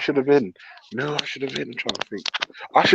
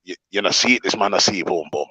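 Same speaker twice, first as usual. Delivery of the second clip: low, patois-speaking dad; imitating dad; 7 semitones lower than usual. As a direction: drop into dad's deep patois voice. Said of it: "he goes to me".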